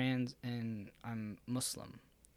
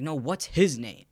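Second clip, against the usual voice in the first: slow, louder mock cop yell; imitating an officer yelling at his girlfriend, slowed and louder; retold cop yell; 2 semitones higher, 8 dB louder, slower than usual. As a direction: bark it out slowly like the cop did. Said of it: "he was, like, yelling at her".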